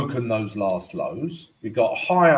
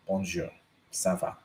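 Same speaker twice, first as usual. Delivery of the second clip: a light, hammed-up French accent, softer; 5 semitones lower, 9 dB quieter than usual. Greeting his viewers in a mock French accent. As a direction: show off your schoolboy French. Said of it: "I apologize for the accent".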